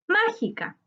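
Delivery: Mágica with MÁ pitched high, then falling; stress on MA. Shown as a demonstration. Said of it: "Su sílaba tónica está en ma". (acento marcado en la MÁ de Mágica)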